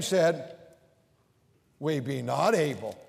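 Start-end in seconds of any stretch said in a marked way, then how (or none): 1.8-3.0 low, soft, whining "We be not able"; whiny defeat. Shown as a demonstration. Preacher voicing the doubting spies, whiny and low. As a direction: sound hopeless and pouty. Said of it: "you have to sort of whine".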